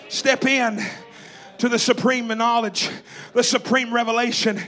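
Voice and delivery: loud, driving sermon cadence; fiery preaching. Acted. Preacher rousing the church at full volume.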